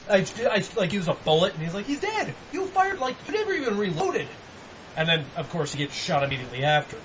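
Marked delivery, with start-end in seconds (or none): 1.9-4.3 high-pitched, incredulous retelling; mock indignant protest. Player retelling the shooting argument, indignant high voice. Acted retort in game story.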